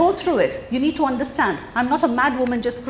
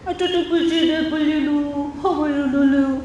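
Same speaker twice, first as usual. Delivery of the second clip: put-on high, very slow cooing to a baby; drawn-out sing-song baby talk; 4 semitones higher, much slower than usual. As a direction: coo it like you're talking to an infant. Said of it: "remember the baby talk that we did".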